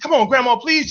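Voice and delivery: put-on high begging child; begging kid. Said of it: "I said".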